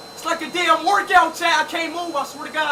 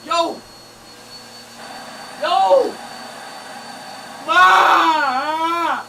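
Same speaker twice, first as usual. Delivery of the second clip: yelling for help; much slower than usual. Real, not acted. Streamer stuck, yelling to his mom and chat for help. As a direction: shout for your mom like you're stuck.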